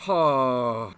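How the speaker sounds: loud shocked "oh"; startled exclamation at the dare; shocked gasp. Real, not acted.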